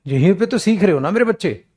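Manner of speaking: ordinary talk, nothing marked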